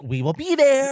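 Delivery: high, loud eager promise; excited. Retold or imitated. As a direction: shout it with glee. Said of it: "I was like".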